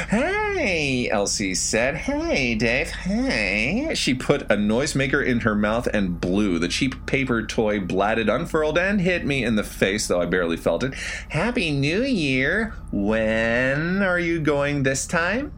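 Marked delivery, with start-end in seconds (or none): 0.1-4.0 high teasing call; eerie woman voice. A narrator's reading. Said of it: "Elsie waking him". 11.3-15.5 high, slow, teasing woman; eerie woman voice. A narrator's reading. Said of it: "Elsie voiced".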